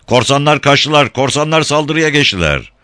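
high-pitched cry of alarm; alarm shout. A narrator's reading. Townspeople warning of pirates with an alarmed shout. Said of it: "diye bağırmışlardı".

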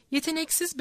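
ordinary delivery

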